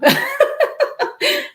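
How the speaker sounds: high-pitched giggling laugh; loud, high giggle at one's own joke. Real, not acted. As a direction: burst of real, high laughter. Laughs at own imagined teenager quote.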